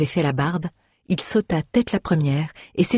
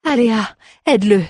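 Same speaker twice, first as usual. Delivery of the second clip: raised, urgent call to companions; exclaiming; 5 semitones higher, 4 dB louder than usual. A narrator's reading. Bard rallies friends to hold the door, exclaiming. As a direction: exclaim it higher, with some force. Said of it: "s'exclama Face".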